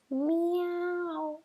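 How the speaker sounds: high, eerie drawn-out meow; a ghost cat moaning to scare; scary ghost meow. Acted. Ghost cat meowing at the mouse, high eerie meow. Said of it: "began to meow in a scary voice".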